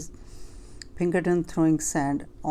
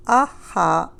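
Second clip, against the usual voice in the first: cackle; 7 dB louder than usual. A narrator's reading. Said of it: "cackled the sandwich".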